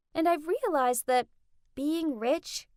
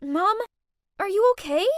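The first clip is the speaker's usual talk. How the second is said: worried; 7 semitones higher, 6 dB louder than usual. Acted. Helps fallen mom up.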